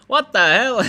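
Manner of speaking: what the hell, loud and high; a loud, amused what the hell; amused surprised outburst; mock disbelief. Real, not acted. Reads viewer's mixed-family comment.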